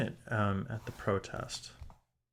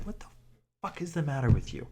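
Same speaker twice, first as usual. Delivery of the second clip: louder, higher, indignant outburst; outraged; 5 semitones higher, 4 dB louder than usual. Real, not acted. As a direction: blast the question at the cop. Outraged at the cop.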